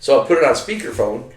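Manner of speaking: ordinary talk, nothing marked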